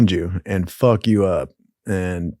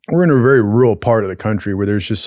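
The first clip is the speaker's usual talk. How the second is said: usual speaking voice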